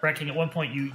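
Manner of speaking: ordinary talk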